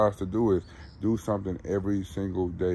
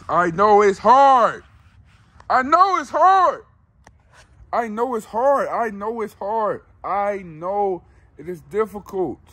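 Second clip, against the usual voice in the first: impassioned preaching; 11 semitones higher, 6 dB louder than usual. Acted. Vlogger urging viewers to act, preaching high and loud. Motivational rant.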